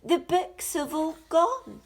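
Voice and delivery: high and loud, distressed; upset cry. A narrator's reading. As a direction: wail that they are gone. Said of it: "cried out Peter".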